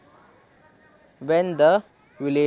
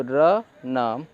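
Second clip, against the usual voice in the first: slow syllable demo; 2 semitones lower than usual. Shown as a demonstration. Teacher sounding out letters to students, very slowly. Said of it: "एक ही एन की आवाज़ आएगी".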